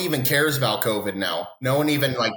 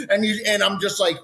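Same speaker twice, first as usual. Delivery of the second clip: ordinary talk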